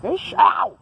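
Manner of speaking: high, loud, slow yelp; mock pain. Vlogger faking a bite from the statue, high and loud. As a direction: pretend the teeth bit you. Pretending to get bitten.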